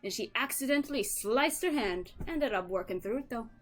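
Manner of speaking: put-on a broader Irish accent; hammed-up Irish brogue telling a story; fully Irish. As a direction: lean harder into the Irish accent. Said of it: "Imagine it being fully Irish".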